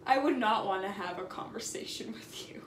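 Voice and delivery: hushed aside, laughing under breath; laughing whisper. Real, not acted. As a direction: mutter it quietly through a laugh.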